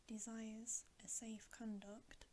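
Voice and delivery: ordinary delivery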